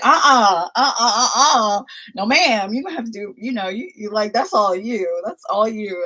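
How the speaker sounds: loud, emphatic refusal in a put-on voice; imitating Mary. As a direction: play a lady flatly turning down the trip. Voicing Mary refusing the trip.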